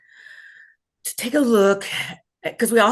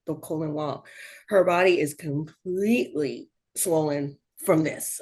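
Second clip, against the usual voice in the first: lower, quieter clinical doctor voice; calm doctor report; 6 semitones lower, 4 dB quieter than usual. Retold or imitated. Doctor to family mid-surgery, low grave report.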